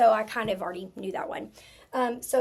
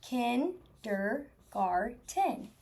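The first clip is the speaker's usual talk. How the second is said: "Kindergarten" drawn out very slowly, each syllable held; slow, soft stretched-out demo word; 4 dB quieter, much slower than usual. Shown as a demonstration. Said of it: "taking my time thinking about what I hear".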